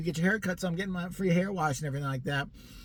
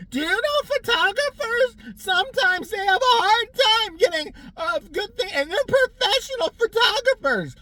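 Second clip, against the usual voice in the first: very high, louder, slow put-on voice; mock chatty customer; 7 dB louder, slower than usual. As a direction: drone it in a squeaky voice. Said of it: "chatty customer replies".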